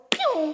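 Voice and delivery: quick high whoosh; imitated zooming sound. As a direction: mimic someone shooting out the door. Imitates people darting out.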